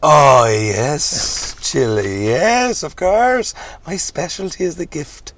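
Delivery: swooping high, drawn-out yeses; over-the-top reverend with soaring pitch; lecherous hammy reverend. Acted. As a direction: ooze eagerness as a showy preacher.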